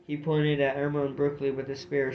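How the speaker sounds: plain reading voice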